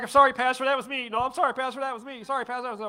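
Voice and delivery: high, loud chorus of sorry; each voice owns up sheepishly; sheepish confessions. Retold or imitated. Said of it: "Everybody's like".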